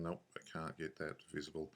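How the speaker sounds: ordinary talk, nothing marked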